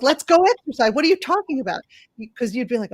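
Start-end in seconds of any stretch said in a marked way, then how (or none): 0.0-1.8 louder, higher impatient scolding; mocking someone nagging a sick relative; mock impatience. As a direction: push a tired person to get moving. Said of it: "You, like".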